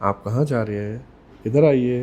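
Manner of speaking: toned-down, polite request to come over. Shown as a demonstration. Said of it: "जैसी tone change करी".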